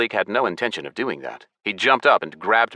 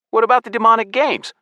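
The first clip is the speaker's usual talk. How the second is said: very high, loud character voice; high-pitched, louder questioning voice; Malik; 6 dB louder than usual. A narrator's reading.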